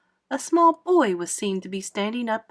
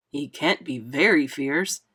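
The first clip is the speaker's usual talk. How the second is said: low, slow police chief voice; exclaimed verdict; 6 semitones lower than usual. A narrator's reading. Head cop reacts to the grasshopper rhyme, exclaiming. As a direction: drop deep and slow, pronouncing judgment. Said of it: "exclaimed the head of all the cops".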